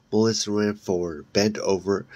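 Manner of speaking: ordinary delivery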